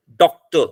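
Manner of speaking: Doctor in two beats, firm on डॉक; pause between syllables. Shown as a demonstration. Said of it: "जहां थोड़ा सा ठहराव आएगा".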